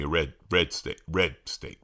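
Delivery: ordinary talk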